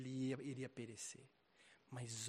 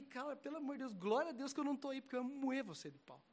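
higher pitched half-joking threat; retold exasperation; 9 semitones higher than usual. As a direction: scold him with mock outrage.

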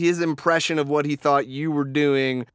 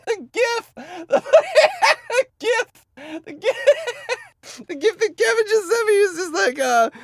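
high-pitched laughing; laughing hard; 5 dB louder, slower than usual. Real, not acted. Friend cracking up over Kevin's gif, high laughing. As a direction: squeal through laughter at the gif.

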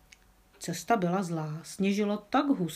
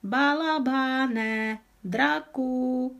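drawn-out call; 6 semitones higher, 4 dB louder, much slower than usual. A narrator's reading.

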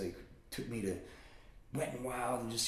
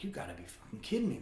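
higher, quick incredulous protest; mock disbelief; 10 semitones higher, faster than usual. Kid questioning his papa about hell, incredulous.

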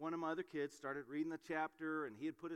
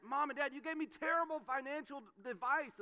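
upset student; 10 semitones higher, 5 dB louder than usual. Retold or imitated. College student complaining to parents.

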